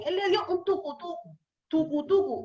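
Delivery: "tucutucu" dicho como un sonido expresivo; histrionismo juguetón. Shown as a demonstration. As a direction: imita el golpe con la voz. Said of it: "aquí estoy haciendo el histrionismo". (ideófono "tucutucu" actuado con gracia)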